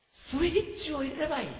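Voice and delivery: 「スイッチを入れればいい」を高い声で柔らかく; 少し声を上げて念を押すように; 高めに言い直す. Shown as a demonstration. (「スイッチを入れればいい」を高めの声で優しく繰り返す)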